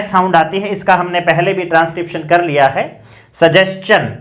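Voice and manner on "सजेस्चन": "सजेशन" as sa-jes-chan, with s-ch; correct ch sound. Shown as a demonstration. Teacher introducing the example, "सजेशन" pronounced sa-jes-chan. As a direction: say "सजेशन" with a clear s then ch. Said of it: "सजेशन नहीं है".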